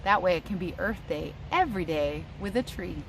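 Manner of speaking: normal talking voice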